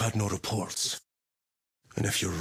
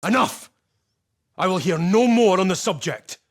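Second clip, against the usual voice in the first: much higher and louder, shut him down; furious shout; 9 semitones higher, 9 dB louder than usual. Acted. Commander silencing Titus about Graia, furious shout. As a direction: slam the argument closed. Superior cuts off argument angrily.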